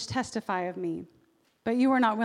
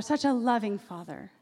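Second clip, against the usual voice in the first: ordinary talk, nothing marked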